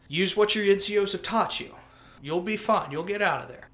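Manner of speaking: lightly acted officer calling down advice; commander. Commander to soldier stuck in a hole, firm encouragement.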